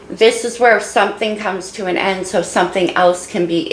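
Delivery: ordinary talk, nothing marked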